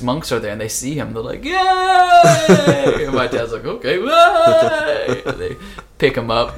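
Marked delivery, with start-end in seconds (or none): 1.5-3.1 high, loud cheering shout; a crowd of monks cheering with joy; cheering yay. Retold or imitated. Monks welcoming the young monk, loud cheering yell. 3.7-5.2 high, loud, slow laughing yay; laughing along. Retold or imitated. Young monk answering the cheering monks, slow laughing yay.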